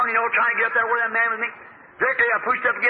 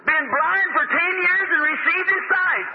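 high-pitched, drawn-out preacher's exclamation; exultant exclamation; 10 semitones higher, slower than usual. Real, not acted. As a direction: ring it out slowly, high above your usual pitch. Exclaiming over a healing in preaching.

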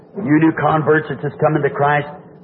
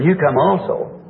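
ordinary delivery

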